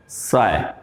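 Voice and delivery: साय said as one glide "sigh"; saying साय slowly, low and soft, like सायकाल; correct vowel. Shown as a demonstration. Teacher models साय for the class. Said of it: "तो सा ई नहीं".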